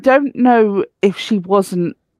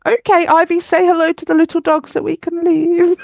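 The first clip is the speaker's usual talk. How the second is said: much higher, nervous sing-song to the dog; flustered high voice; 9 semitones higher than usual. Retold or imitated. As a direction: hurry the dog along politely, pitched up. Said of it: "And I was like".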